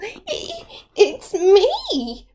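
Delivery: high, giggly squeal; squealing little goat. Acted. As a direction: squeak it out nervously with a laugh. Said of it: "Baby Gruff squealed".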